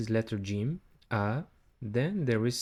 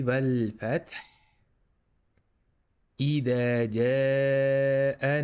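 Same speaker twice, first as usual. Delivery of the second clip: chanted recitation, stretched جاء; Quran recitation. Shown as a demonstration. Teacher recites the verse.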